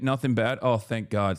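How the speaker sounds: ordinary talk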